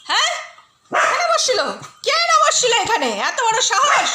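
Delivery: high-pitched playful indignation; hammed-up indignant pet talk; mock outrage. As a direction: pretend to be scandalised for the dog. Mock outrage on dog's behalf.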